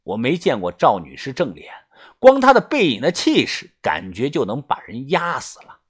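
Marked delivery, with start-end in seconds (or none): none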